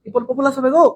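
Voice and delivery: high, whiny mock student voice; mocking a confused student. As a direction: wail like a student who is lost. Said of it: "বেশিরভাগ student ধুয়ে answer করে". (উঁচু গলায় বিভ্রান্ত ছাত্রের নকল)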